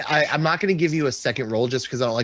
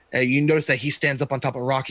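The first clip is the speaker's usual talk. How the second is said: normal talking voice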